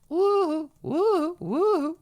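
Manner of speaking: three quick high whoops; light, high-pitched "woo" on repeat; playful whoops. Real, not acted. Playful noises while resetting the setup.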